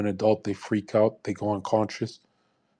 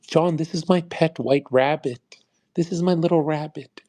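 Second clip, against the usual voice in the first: higher-pitched mocking voice showing off a pet; 6 semitones higher, 4 dB louder than usual. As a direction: coo over the little rabbit in a mock-sweet voice.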